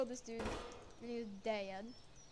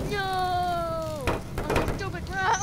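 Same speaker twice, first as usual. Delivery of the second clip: loud, high acted outcry; wailing shout; 8 semitones higher, 9 dB louder, much slower than usual. Defeated toy crying out at his end, wailing. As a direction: wail it at the top of your voice.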